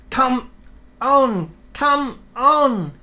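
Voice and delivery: high, loud puffing urge; puffing engine. Acted. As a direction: chug the trucks along. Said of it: "he puffed".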